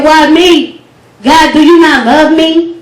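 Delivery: mildly heated poetic protest; angry, upset plea. Retold or imitated. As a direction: recite it as a pained challenge to God. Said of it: "Angry and upset with God".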